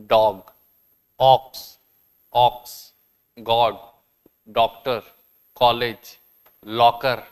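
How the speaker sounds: each word with a half-open ऑ; ऑ examples. Shown as a demonstration. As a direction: say डॉग, ऑक्स, गॉड with a clear ऑ. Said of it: "example words with ऑ". (ऑ वाले शब्द साफ़ बोलें)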